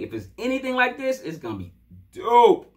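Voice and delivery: builds up then shouts dope; hyped. Real, not acted. Fan hyping the coming album, loud on dope. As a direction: hold it, then blast the last word.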